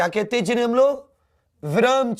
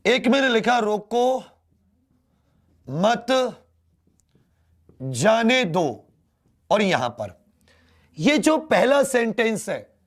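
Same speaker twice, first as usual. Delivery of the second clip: usual speaking voice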